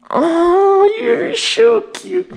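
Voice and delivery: very high, drawn-out pet coo. Real, not acted. Owner to his little dog, high cooing. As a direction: gush over a cute dog in a high squeal.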